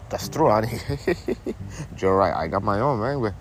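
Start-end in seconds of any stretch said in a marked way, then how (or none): none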